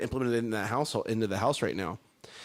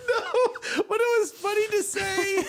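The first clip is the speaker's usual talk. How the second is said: high giggly laugh through words; slower than usual. Real, not acted. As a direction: laugh while you say it. Laughing high after joke.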